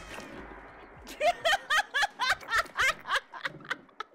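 shrill laugh after the scare; high nervous laugh. Real, not acted. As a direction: laugh off the terror.

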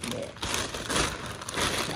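voiceless whisper to self; hushed murmur while unpacking. Real, not acted. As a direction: breathe it out, barely there. Muttered to self.

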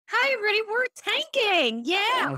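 high-pitched, hammed-up town hall hype; mismatched cheer. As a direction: announce bad company news with absurd glee. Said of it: "You go into your town hall for your company".

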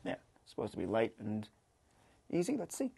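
normal talking voice

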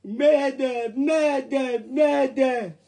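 loud hammed-up distress call; calling mayday, half joking; mock mayday call. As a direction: cry it out like a radio distress signal.

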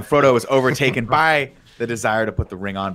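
ordinary talk

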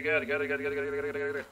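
ordinary talk

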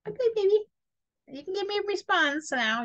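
high, sweet coaxing of a newborn; coaxing baby talk. Retold or imitated. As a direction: coax the baby to respond. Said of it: "I used to do their little feets".

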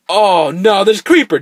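high, loud warning; alarmed cry. Real, not acted. Player spotting a creeper, high loud alarm. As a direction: jolt of alarm.

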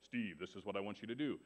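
low, softened booming-God imitation. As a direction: speak down from heaven, low and hushed. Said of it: "this, this God voice and say".

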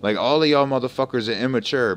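loud heated call-out; a streamer scolding the whole clan in his stream; angry scolding. Real, not acted. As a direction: tell off the whole lobby.

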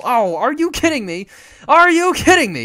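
are you kidding me, yelled at the screen; exasperated outburst. Real, not acted. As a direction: blow up at the bad luck. Bad card draw frustration.

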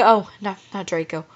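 usual speaking voice

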